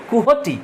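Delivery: কুহটি as koo-ho-tee, with a clear falling tune; correct pronunciation. Shown as a demonstration. Teacher to class, repeating the name to fix its sound, clear demo. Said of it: "এরকম উচ্চারণ হয়".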